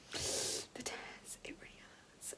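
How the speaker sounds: unvoiced whisper, slow at the end. Real, not acted. New mother whispering to viewers about her stitches, softly. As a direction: hush it as if confiding.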